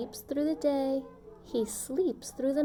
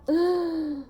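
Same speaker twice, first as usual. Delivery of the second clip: sharp gasp; 5 semitones higher, 4 dB louder than usual. A narrator's reading. Crowd freezes.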